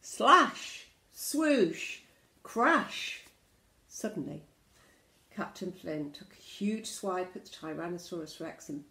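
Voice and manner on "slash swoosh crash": loud, high bursts for a swordfight; explosive storybook sound effects; battle exclamations. Acted. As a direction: punch each action word like a blow. Sword fight sound words.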